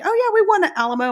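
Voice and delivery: high, loud and fast, cheerfully sure of the wrong fact; proud mock boast. Telling her kids about the Alamo, a quick, bright boast. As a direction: rattle it off like a proud Texan kid. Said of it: "I'm like".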